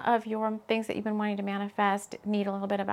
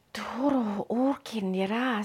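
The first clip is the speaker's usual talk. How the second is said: whispered light language; slower than usual. Acted.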